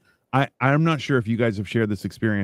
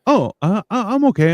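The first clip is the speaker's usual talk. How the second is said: high, hesitant mimic of a clerk; higher, halting reply; taken aback; 10 semitones higher, 4 dB louder than usual. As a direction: imitate someone caught off guard. Retold clerk, taken off guard.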